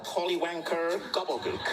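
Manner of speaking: high-pitched mock incantation; Harry Potter spell. Speaker jokes about British insults, mock spell-casting. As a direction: intone it like a magic spell. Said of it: "It's like a Harry Potter spell".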